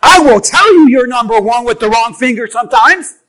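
ordinary delivery

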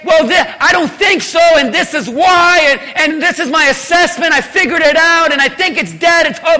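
a lightly hammed-up know-it-all; mock hedging reasoner. Preacher mocking what Ezekiel didn't say, hammed-up. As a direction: stammer out a smug excuse. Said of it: "Ezekiel didn't say".

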